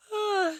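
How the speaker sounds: big exhausted sigh, real; a sudden heavy sigh mid-story; loud weary sigh. Real, not acted. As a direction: let out a long tired sigh. Tired storyteller breaks off.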